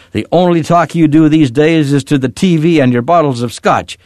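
angry accusation. A narrator's reading. Daughter pounds the table accusing Papa, angry.

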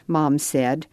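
plain reading voice

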